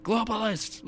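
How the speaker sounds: high ranting mock conspiracy voice; Alex Jones impression. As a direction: spit out "Globalist" like a ranting radio host. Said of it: "like that".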